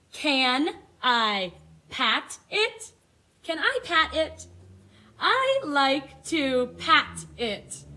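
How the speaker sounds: slow, bright storybook read-aloud; reading to children. Acted. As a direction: read the book slowly to little kids. Reads picture book to class.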